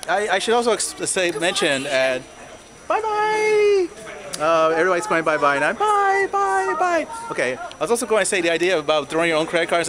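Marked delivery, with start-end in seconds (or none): 2.9-3.9 sing-song bye bye. Real, not acted. Waving off passers-by, high sing-song farewell. Waving goodbye to passing people. 5.7-7.1 sing-song bye bye. Real, not acted. Waving off passers-by, high sing-song farewell. Waving goodbye to passing people.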